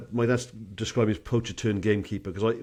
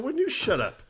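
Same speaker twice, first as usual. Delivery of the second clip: echoed back much higher; mocking echo; 13 semitones higher than usual. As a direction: throw it right back, squeaky. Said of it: "I said".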